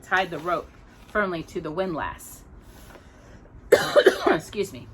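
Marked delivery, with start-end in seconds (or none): none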